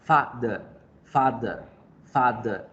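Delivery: "father" as FA-thuh, strong FA, weak schwa ending; strong first syllable. Shown as a demonstration. Teacher demonstrating the weak syllable, "father" ending in schwa. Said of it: "Por eso digo father".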